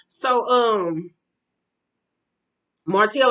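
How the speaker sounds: ordinary talk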